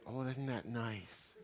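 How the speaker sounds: soft, slow, sugary mock approval; gently mock the audience's easy reaction; mock sweet voice. Speaker teasing the audience about their nice first thought, slow soft mock sweetness. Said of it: "you're probably all thinking".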